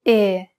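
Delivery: Italian "E" as narrow "ay" without a glide; closed E. Shown as a demonstration. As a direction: keep the mouth narrower for a pure closed "E". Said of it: "followed by a closed E".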